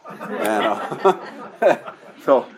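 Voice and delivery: chuckling through filler words; amused laugh mid-sentence. Real, not acted. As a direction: laugh at the irony. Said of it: "laughs at his joke".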